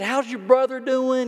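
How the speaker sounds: light up at a familiar face; excited greeting. Retold or imitated. To the waiter, a former player recognized, excited greeting.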